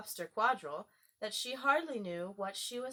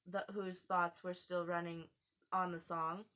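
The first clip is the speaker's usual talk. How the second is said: ordinary delivery, nothing marked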